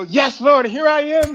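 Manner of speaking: high, loud hammed-up readiness; mock eager devotion. Chaplain mimicking an instant yes to God, mock zeal. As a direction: cry it out like an overeager volunteer.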